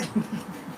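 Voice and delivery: short breathy giggle; a quick laugh slips out. Real, not acted. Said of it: "I don't know why I'm laughing".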